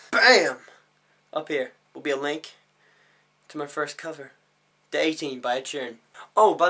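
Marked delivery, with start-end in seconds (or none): 0.1-0.6 high, loud pop; loud sound-effect burst; punchy exclamation. Pretend. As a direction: punch it out suddenly. Pointing out a link.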